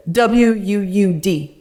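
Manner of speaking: slow, loud mock-Cajun spelling; Cajun accent. Cajun hunter asked to spell wood, Cajun accent. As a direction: spell it letter by letter, imitating a Cajun. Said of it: "he's so Cajun when he talks".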